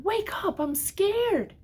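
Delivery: put-on high childlike lamb voice, lightly afraid; scared lamb voice. A narrator's reading. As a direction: plead like a scared little lamb waking his friend. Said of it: "he whispered".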